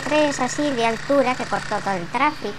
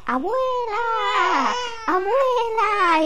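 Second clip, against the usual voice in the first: llamada lenta y mucho más aguda de niño; niño dando voces; 9 semitones higher, much slower than usual. Acted. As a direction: alarga ¡Abuela! como un crío berreando. (niño repugnante llamando a la abuela, muy agudo y alargado)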